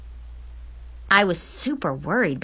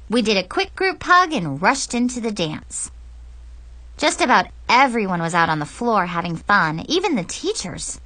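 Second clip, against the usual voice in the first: plain reading voice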